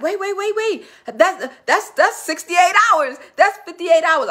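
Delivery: imitating a flustered friend, high and urgent; frantic high protest. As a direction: squeak out a hurried objection, rising in pitch. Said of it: "She's like".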